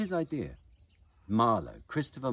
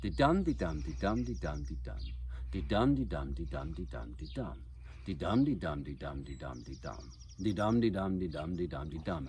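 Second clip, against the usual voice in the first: unbroken runs of di-dum, DUM stressed; steady iambic rhythm. Shown as a demonstration. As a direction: roll out di-dum line after line, each starting strong and falling away. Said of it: "Marlowe's speeches goes something like this".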